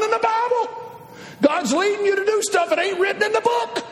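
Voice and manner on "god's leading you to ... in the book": shouted, higher preaching; preaching at full force. Acted. Preacher pressing the missionaries, shouting.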